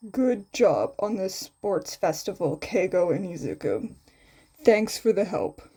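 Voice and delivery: bored tone. A narrator's reading. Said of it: "in his usual bored tone".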